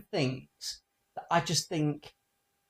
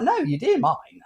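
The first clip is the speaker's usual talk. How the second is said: daughter's retort; 7 semitones higher, 7 dB louder than usual. Retold or imitated. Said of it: "She's like".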